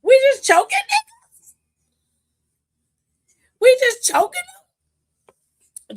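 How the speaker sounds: very high, loud mock disbelief; hammed-up gasp of disbelief; shocked exclaim. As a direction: squeal it out in shocked amusement. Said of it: "I said".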